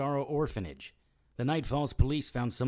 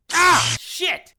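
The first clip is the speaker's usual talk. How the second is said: loud, high cries and curses of pain; shouting in pain; 13 dB louder, much slower than usual. Acted. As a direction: curse out loud as each shock hits. Shocked again with the prod.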